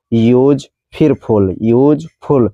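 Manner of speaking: यूज and फुल as two slow, separate beats; slow two-breath यूज ... फुल; split into syllables. Shown as a demonstration. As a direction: break यूज फुल into यूज, pause, फुल. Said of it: "एक सांस में ये word नहीं निकल रहा है".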